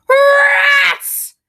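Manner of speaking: very high, loud panicked cry; terrified shout. A narrator's reading. Coachman screams as a rat lands on him. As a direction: shriek at the sight of the rat. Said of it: "he shouts".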